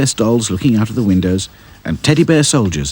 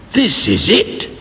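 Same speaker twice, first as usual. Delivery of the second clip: gasping; 13 semitones higher, slower than usual. A narrator's reading. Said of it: "he gasped".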